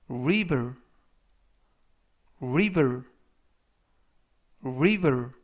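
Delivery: river repeated slowly with a clear r and v; river pronunciation. Shown as a demonstration. Teacher modelling river for students, slow and clear. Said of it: "이라고 발음하시면 되겠고요".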